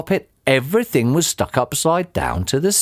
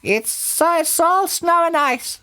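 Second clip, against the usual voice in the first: high-pitched bird character; roly-poly bird. A narrator's reading. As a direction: warn in a very high creature voice. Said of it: "said the roly-poly bird".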